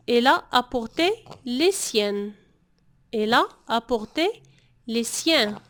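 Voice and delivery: slow, careful French demo; slow model sentence. Shown as a demonstration. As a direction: spell it out slowly for the class. (نطق بطيء وواضح للمتعلمين)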